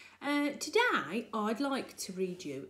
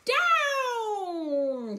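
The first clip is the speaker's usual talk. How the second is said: high, loud shout of "Down!"; the witch commands the broom down; shrill call from a witch steering her broom; witch's cry; 10 semitones higher, 6 dB louder than usual. A narrator's reading. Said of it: "cried the witch".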